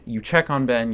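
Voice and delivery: ordinary talk, nothing marked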